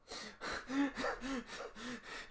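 high, breathy giggling; amused giggle at the ace line. Real, not acted. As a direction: giggle softly. Laughing at Hinata scene.

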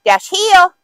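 sharp high command to a dog; firm dog commands. Acted. Handler commanding dog to heel.

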